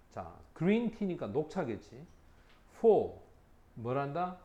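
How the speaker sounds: ordinary talk, nothing marked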